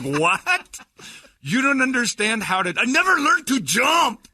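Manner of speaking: high, loud, slow whiny shout; husband voice. Retold or imitated. Imagined dialogue with the husband.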